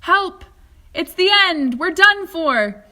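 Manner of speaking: loud, high panicked cry, slow at the end; an old man sure they're doomed; frightened yelling; terrified shout. A narrator's reading. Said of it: "And Grandpa Joe shouted".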